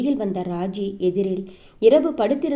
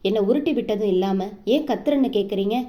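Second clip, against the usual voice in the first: plain reading voice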